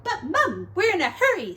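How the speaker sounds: pleading to rush out; impatient piglet. Acted. Piglet protesting to mother.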